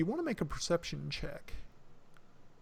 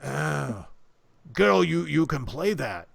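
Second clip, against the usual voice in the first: loud, booming orc voice; Gorthal; 8 dB louder, slower than usual. Acted. Orc urging the girl to play her instrument, loud. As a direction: call it out like a big eager orc. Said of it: "Gorthal looks over and says".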